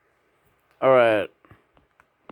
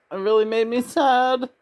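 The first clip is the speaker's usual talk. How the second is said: high-pitched plaint; 9 semitones higher than usual. Real, not acted. Lamenting a sad movie, high-pitched. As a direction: voice climbing very high.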